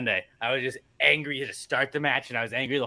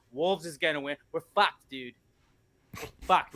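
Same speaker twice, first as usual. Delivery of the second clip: ordinary delivery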